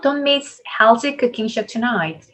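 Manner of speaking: slow model reading stressing miss, cooking show, tonight; key words stressed. Shown as a demonstration. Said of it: "동사 그리고 고유명사 시간 순서로 강세죠". (Don't miss, cooking show, tonight에 강세를 두고 천천히)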